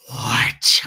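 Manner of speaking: very low, loud suspicious challenge; ready to snap. Retold or imitated. Shopper to driver who stopped her, mock-aggressive low challenge. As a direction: drop the pitch and confront her, hammed up. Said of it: "ready to bite this lady's head off".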